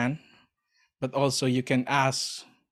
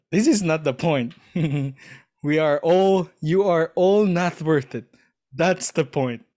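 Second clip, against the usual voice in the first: loud mock rebuke with a chuckle; emphatic, raised retold telling-off; Jesus; 4 semitones higher, 6 dB louder than usual. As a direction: imitate a master setting his followers straight. Acts out Jesus rebuking the disciples.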